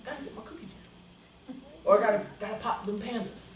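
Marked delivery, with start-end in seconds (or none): 0.0-1.6 whispered greedy mutter; unvoiced mock craving. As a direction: whisper it like an addict. Mocking his game craving.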